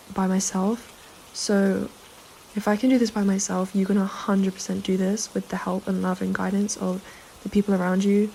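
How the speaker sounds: usual speaking voice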